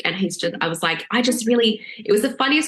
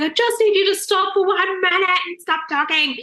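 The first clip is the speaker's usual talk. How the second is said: high and tense; exasperated plea; 11 semitones higher than usual. Retold or imitated. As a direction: beg him to just stop talking. Snapping at husband in labour.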